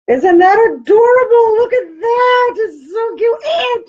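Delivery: very high, loud, squealing with tears of joy; delighted squeal. Real, not acted. Crafter revealing matching socks to viewers, delighted squeal.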